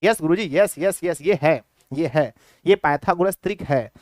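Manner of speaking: lightly voiced chorus of agreeing pupils; pupils answering imitated. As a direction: chant it like a class replying to the teacher. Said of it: "तो बोलेंगे". (छात्रों के जवाब की हल्की नकल)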